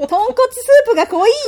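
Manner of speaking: 高く大声でふざけて茶化す; 女友達の引いた声を大げさに真似る; 大げさな冷やかし. Acted. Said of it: "みたいな感じで。". (大げさに茶化す甲高い声)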